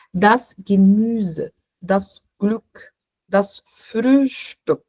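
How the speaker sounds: Gemüse, Glück, Frühstück with rounded Ü, said slowly; slow German Ü words. Shown as a demonstration. Teacher slowly reading German words with Ü to the class. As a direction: stretch each Ü word out clearly. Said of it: "e tem o Ü".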